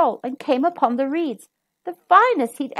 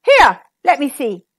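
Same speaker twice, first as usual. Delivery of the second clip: angry demand; 8 dB louder than usual. Acted. Angry king demands pipe.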